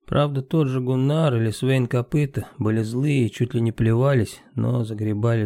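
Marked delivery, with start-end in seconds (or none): none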